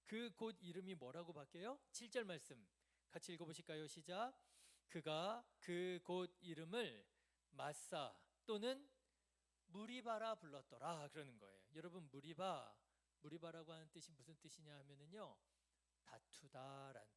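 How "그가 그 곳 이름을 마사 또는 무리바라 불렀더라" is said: loud, slow, measured reading; scripture reading. Real, not acted. Preacher leading the congregation in the verse, loud and deliberate. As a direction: read it out strongly for all to follow.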